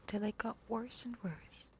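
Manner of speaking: ordinary delivery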